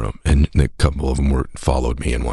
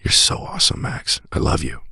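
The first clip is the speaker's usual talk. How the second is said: put-on soft, slowed, high baby talk; tender to newborn; 14 semitones higher, 3 dB quieter than usual. Father to his newborn son, soft and tender. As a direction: coo it like holding a newborn son.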